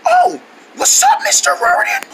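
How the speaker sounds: very high, strained wheezy squeak; wheezy squeak of someone who screamed too much; lost voice. Acted. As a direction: squeeze the words out through a blown-out voice. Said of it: "what's with the new voice?".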